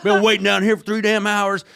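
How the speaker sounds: high, loud furious yell at her; retold angry yelling. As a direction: yell it like a driver who's waited three hours. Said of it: "he starts yelling at her".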